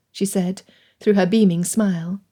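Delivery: usual speaking voice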